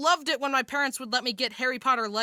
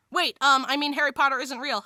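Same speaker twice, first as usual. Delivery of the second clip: normal talking voice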